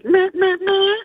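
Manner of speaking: high whiny meh meh; mock the droning chatter; mocking blah blah. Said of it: "All this politics talk".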